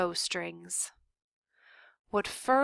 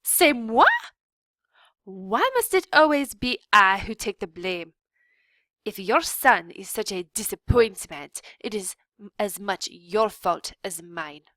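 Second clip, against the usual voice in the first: much louder French indignation; offended outburst; 2 semitones higher, 8 dB louder than usual. A narrator's reading. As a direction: fling the blame back, French accent. Antoinette answers the blame.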